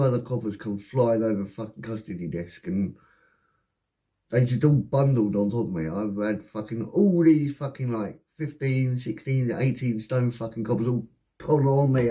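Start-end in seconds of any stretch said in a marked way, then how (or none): none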